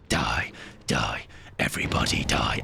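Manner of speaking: very low, croaky villain chant; deep mock-evil death chant; mock sinister. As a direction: intone it low and menacing. Performed death chant, then explains.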